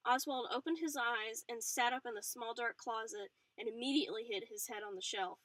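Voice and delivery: plain reading voice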